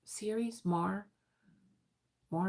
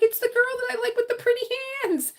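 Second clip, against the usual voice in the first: very high, rapid gushing; over-the-top high-pitched excitement; excited squeal; 3 dB louder, much faster than usual. Retold or imitated. Acted gushing over returning viewer.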